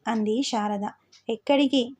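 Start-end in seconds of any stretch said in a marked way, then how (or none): none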